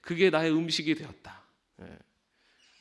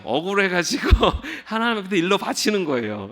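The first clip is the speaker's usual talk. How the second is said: louder and higher, laughing through a playful tattle; 5 semitones higher, 8 dB louder than usual. Pretend. Preacher joking to the congregation about tattling to God, laughing. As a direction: tell on them to God with a giggle.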